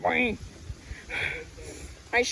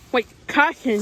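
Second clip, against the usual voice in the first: usual speaking voice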